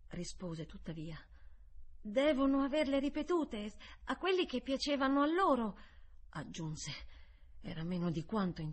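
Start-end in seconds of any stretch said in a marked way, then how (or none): 2.0-5.8 higher-voiced child explaining; boy's answer. A narrator's reading. Boy answers the governess.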